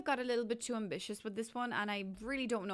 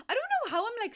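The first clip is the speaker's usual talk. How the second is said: higher and louder, fed up; exasperated outburst; 12 semitones higher, 7 dB louder, much faster than usual. Real, not acted. Builder venting at a house that won't work, exasperated and loud. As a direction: burst out in real frustration. Frustrated at her own build.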